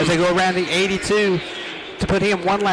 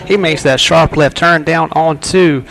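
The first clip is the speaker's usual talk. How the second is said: excited race call; 9 dB louder than usual. Real, not acted. Live race call, green flag.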